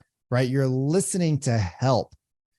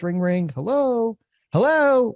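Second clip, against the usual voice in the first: second hello much higher and louder; upset high hello; 9 semitones higher, 3 dB louder, slower than usual. Retold or imitated. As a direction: answer the phone irritated. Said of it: "I can't even get that high of a pitch".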